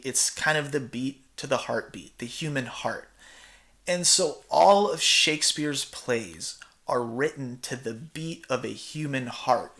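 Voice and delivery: normal talking voice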